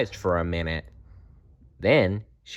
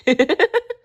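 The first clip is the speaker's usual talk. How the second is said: short high-pitched giggle; a tickled bear's burst of giggles; 5 dB louder than usual. A narrator's reading. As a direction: squeal a quick giggle. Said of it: "Friend Bear giggled".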